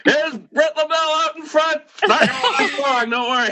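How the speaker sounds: high, mocking sports commentator; mock announcer voice. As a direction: ham up a play-by-play call. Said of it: "and I go".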